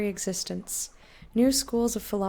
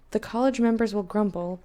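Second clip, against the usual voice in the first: normal talking voice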